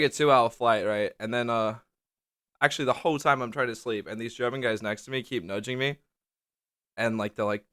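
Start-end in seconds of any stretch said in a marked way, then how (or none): none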